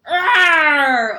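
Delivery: huge shouted roar; loud bear roar. Acted. Bear roaring at the question of jam. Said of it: "Old Bear growled".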